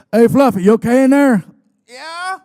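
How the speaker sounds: loud high holler; shouting through door. Retold or imitated. Wrestler yelling through the bathroom door at his guest, loud.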